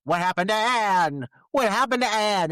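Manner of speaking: high, loud, insistent imitation; mock angry demand. Podcaster as man demanding answers in lab, mock angry demand. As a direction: hammer the question out, rising in force. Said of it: "pounding his fist and being like".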